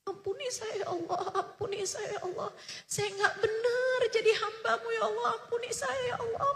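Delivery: imitating a man sobbing out apologies to God; fading, tearful whispered begging; weeping prayer. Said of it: "nangis lagi beliau".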